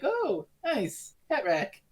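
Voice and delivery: high, then dropping, pleased mock remark; mock admiration. Retelling the Doctor finding a hat rack, mock admiration. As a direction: imitate being taken with a find. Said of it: "and he's like".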